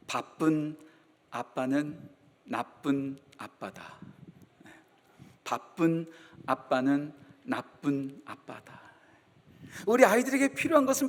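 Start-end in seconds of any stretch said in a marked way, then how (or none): 0.1-4.0 each word set apart, slow and soft; slow quiet saying. Shown as a demonstration. Pastor quoting his favorite saying, slow and soft. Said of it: "제가 자주 하는 말 가운데 이런 말이 있죠". 5.4-8.8 slow and soft, word by word; slow soft repeat. Shown as a demonstration. Pastor repeating his motto to the congregation, slow and soft. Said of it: "repeat of the saying".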